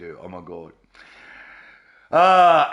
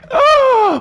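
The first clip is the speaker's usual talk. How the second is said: loud, high mock gasp; mock horrified gasp; 7 dB louder than usual. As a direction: gasp in exaggerated horror. Mock horror at making them derank.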